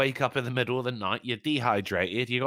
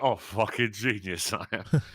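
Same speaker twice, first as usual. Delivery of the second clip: smug self-praise; 4 semitones lower, slower than usual. Retold or imitated.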